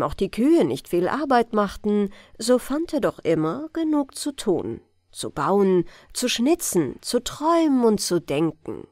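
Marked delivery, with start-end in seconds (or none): none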